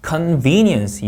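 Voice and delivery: CONVENIENCE with VEN punched; CONVENIENCE, weight on VEN; second-syllable stress. Shown as a demonstration. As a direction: hit the middle of CONVENIENCE. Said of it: "이음절 강세입니다".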